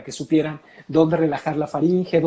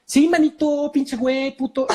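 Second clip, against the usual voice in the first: higher, cartoonish mock Mexican slang; fake Mexican accent; 9 semitones higher than usual. Foreign colleague insists in caricature Mexican, mock Mexican accent. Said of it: "me llegan y me dicen".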